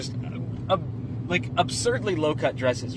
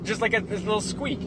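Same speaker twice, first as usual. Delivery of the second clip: normal talking voice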